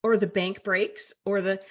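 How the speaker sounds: usual speaking voice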